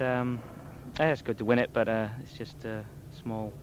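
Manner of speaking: quiet, deflated and flat; low-energy, downcast answer; subdued. Real, not acted. Subdued rider after yesterday.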